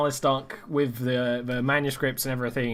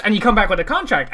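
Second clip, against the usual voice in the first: ordinary talk, nothing marked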